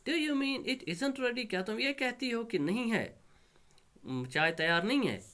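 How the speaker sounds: high-pitched indignant daughter; angrily. Acted. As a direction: demand it high, in acted annoyance. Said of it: "Doris छ वनान angrily".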